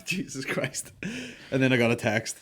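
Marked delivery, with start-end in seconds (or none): 0.0-0.9 breathy whispered aside; real whispered exclamation. Real, not acted. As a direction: whisper it under your breath. Whispered aside after laugh.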